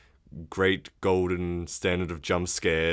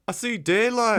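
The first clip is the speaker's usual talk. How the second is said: quoting Holly; 14 semitones higher than usual. Retold or imitated. Holly voiced in retelling.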